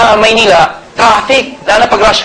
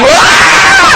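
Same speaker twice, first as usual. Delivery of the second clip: mock startled yelp. Speaker mimicking a first-time MRT rider being shoved, yelping. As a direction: yelp like you're pushed onto the train.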